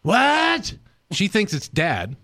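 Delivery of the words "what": imitating hard-of-hearing mom. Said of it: "And so she goes".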